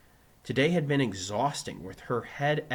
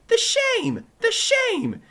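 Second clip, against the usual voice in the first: very high, loud exclamations; jubilant shout; 11 dB louder, much slower than usual. Acted. Pupil answering teacher's quiz, jubilant shout. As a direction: shout the answer at the top of your pitch.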